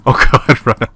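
panicked laugh. Real, not acted.

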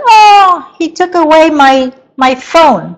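put-on high, loud whiny kid voice; complaining child. Teacher imitating a child who complains his phone was taken. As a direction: play a child tattling about a phone. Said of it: "there's another child who said".

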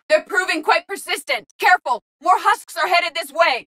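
high-pitched combat callouts; urgent warning. Acted. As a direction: alert the squad to danger. Warning of approaching husks.

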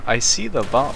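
ordinary delivery

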